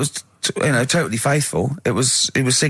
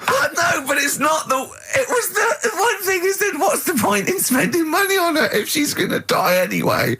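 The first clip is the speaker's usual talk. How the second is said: very high, strained with laughter; real laughing disbelief; laughing through words. Real, not acted. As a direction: protest while crying with laughter. [name] laughing while protesting.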